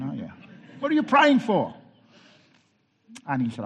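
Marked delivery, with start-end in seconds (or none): 0.8-1.7 "What are you praying for?" much louder and higher, stressing "praying"; mock outrage at the question; incredulous repeat. Pastor repeats the widow's question to the congregation in loud mock disbelief. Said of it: "you're not supposed to ask that question".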